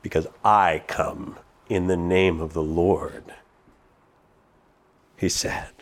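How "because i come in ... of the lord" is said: low, soft "Because I come in the name of the Lord" with "I" leaned on; hushed stress on I. Shown as a demonstration. Preacher answering his own question with David's words, hushed. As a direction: drop low and hush, weight on "I".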